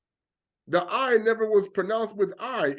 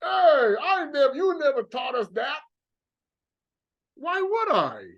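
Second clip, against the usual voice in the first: mock protest; 9 semitones higher than usual. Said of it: "Even Shaitani gonna be like".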